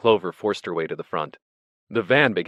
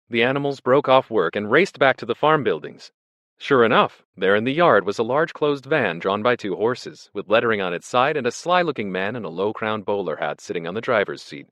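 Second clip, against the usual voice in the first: ordinary delivery, nothing marked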